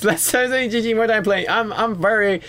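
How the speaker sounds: high, amused laughing voice; laughing at your own grumpiness. Real, not acted. Laughs at own grumpy game chat.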